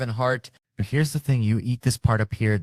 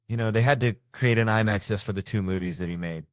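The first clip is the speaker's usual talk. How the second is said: usual speaking voice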